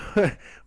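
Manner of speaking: a short loud laugh breaks out; burst of laughter. Real, not acted. Laughing at his friend's confession, a short loud laugh.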